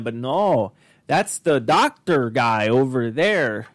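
high, slow broken-English monster talk; hammed-up Frankenstein rant in accent; Tommy Wiseau impression. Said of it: "Tommy Wiseau did get a little Canadian".

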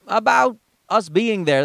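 usual speaking voice

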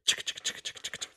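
unvoiced chick-chick rhythm fading out; whispered beat. Shown as a demonstration. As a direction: tick out the theme's beat under breath. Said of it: "People's Court theme".

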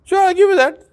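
sarcastic. Retold or imitated. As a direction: toss it off with biting sarcasm. Mocking reply to the beggar.

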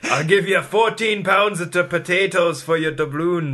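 hammed-up Irish brogue, drawn out; Irish accent. Mocking an Irish trader in a put-on Irish accent. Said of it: "Is that your Irish accent? You sound Scottish".

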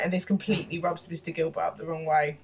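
ordinary talk, nothing marked

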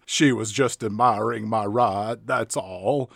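low mock Southern drawl; low, put-on Southern accent; Sean Gump. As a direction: imitate a low-voiced Southern hero. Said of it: "a character that spoke like this".

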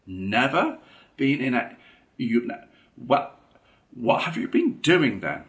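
sputtering in open-mouthed disbelief; astonished. A narrator's reading. Rat can't believe Mole never boated, astonished. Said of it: "cried the rat, open-mouthed".

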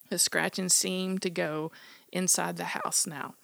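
plain reading voice

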